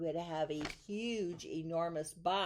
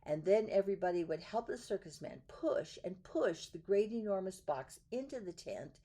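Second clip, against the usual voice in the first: plain reading voice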